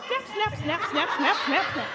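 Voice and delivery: ordinary talk, nothing marked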